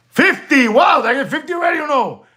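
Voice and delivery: high, quick burst of delight; thrilled the count is climbing; fast, high excited exclaim. Real, not acted. Viewer count nearing fifty.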